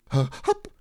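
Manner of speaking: small high hiccup cutting in; squeaky hiccup escaping by accident. A narrator's reading. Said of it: "said Roo again".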